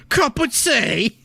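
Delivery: very slow, high, laughing mock British; British accent. Gamer laughing through the British joke, in a slow mock British accent. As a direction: stretch it out like a giggling toff. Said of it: "Speak English, man".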